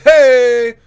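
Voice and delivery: high, very loud yelp of hey; mocking coach's shout. Mimicking the coach shouting at every jab. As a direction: yell hey like the annoying cornerman. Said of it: "he's like".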